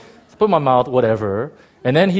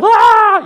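loud, high-pitched mock wail; imitated wailing holler; 14 dB louder than usual. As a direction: holler out like a crowd crying aloud. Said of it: "They're hollering. They're like".

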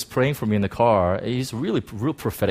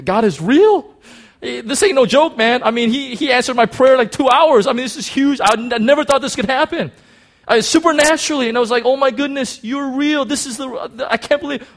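much higher and louder, tumbling out in awe; overwhelmed excitement; 13 semitones higher, 6 dB louder than usual. Retold or imitated. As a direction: let the wonder run away with you. Retold moment realising God is real.

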